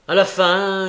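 usual speaking voice

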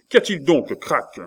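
high, loud impatient question; irritated demand. A narrator's reading. Cornelius to the intruding Crac, irritated demand. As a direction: demand to know what the matter is.

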